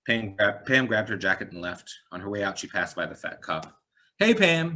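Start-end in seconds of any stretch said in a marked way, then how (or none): 4.2-4.8 cheerful call. Acted. Cop calling out cheerfully.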